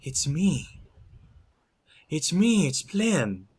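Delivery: high, urgent plea; pleading. Acted. Werewolf turns to the screaming woman, pleading. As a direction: plead to be recognised, pitch leaping up.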